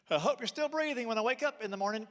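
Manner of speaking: put-on high, loud eerie little boy; creepy kid voice. Son to dad at bedtime, eerie high voice. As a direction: say it like a spooky child.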